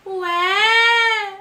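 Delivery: very high, loud mock Korean squeal. Showing off emulated languages, squealed mock Korean. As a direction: stretch the Korean words into a piercing whine. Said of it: "I can literally emulate any language".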